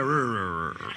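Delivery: low, trailing "rrr" noise; mumbled growl imitation. Speaker mimicking audience members unsure of the names, low mumbled rumble. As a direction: imitate a crowd stumbling over the answer. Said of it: "Some of you are like".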